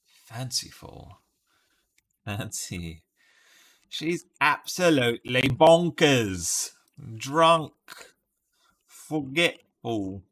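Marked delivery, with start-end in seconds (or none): 3.9-10.2 loud, raised-pitch attempt at Cockney; poor Cockney accent. Player guessing words for Robbie, bad mock Cockney accent. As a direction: put on a hammed-up, not very good Cockney. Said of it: "Cockney accent. Not very good".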